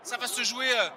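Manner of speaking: fast live rugby play-by-play; live sports caster. Real, not acted. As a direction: narrate the action as it unfolds. (commentateur sportif en direct)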